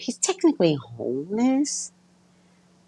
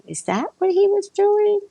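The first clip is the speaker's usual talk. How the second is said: much higher, louder dawning surprise; sudden realisation; 4 dB louder than usual. Retold or imitated. Realising the homeless man had been protecting her, surprised. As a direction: let the realisation jump up in pitch.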